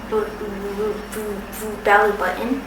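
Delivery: stuck on the b, repeating it; real stutter block. Real, not acted. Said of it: "I am a person who stutters".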